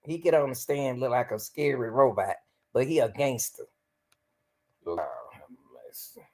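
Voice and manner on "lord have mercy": deep, soft mutter; deep mock groan. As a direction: drop way down low. Mocking the defendant's demeanor.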